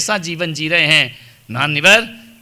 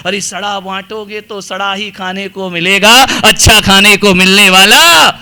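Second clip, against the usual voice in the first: loud taunt building to a shout; scolding daughter-in-law; 3 semitones higher, 10 dB louder than usual. Acted. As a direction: ramp up into a full-voiced rebuke. बहू का ससुर को ताना. (ज़ोर से चिल्लाकर ताना)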